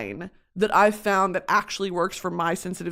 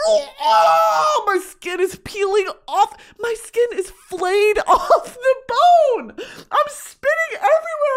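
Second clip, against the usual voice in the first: much higher and louder, slow laughing wail; hammed-up laughing shriek; 13 semitones higher, 5 dB louder, slower than usual. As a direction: scream it like a horror victim. Reliving skin peeling.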